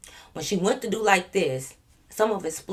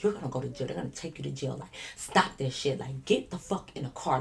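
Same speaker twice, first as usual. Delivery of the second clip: soft, low, near-whispered warning; urgent hush; 4 semitones lower, 7 dB quieter than usual. Retold or imitated. Friend holding the drunk girl's face, hushed urgent warning. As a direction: press it on her quietly, eye to eye. I'm looking her in her eyes.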